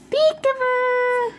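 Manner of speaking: very high, slow, sing-song peekaboo; peekaboo call. Real, not acted. Playing peekaboo with baby.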